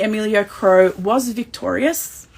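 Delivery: ordinary talk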